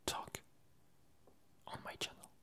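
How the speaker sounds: ordinary talk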